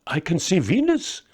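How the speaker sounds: put-on high, eager old voice; old man declaring. A narrator's reading. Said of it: "Balthazar declared".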